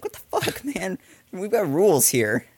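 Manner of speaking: high, hushed disbelief slowing into protest; mock indignation. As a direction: protest a broken rule, half laughing. Voicing complaint at rule-breaking player.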